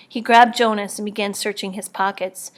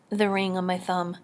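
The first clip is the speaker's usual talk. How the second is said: ordinary delivery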